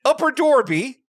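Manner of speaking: high, hammed-up Philadelphia vowels; mock Philly place-name; Philly accent. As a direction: lean into a cartoon Philly accent. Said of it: "she does a Philly accent?".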